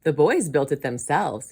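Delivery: loud, proud boast; lightly acted excited uncle. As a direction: brag with a touch of excitement. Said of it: "he said excitedly".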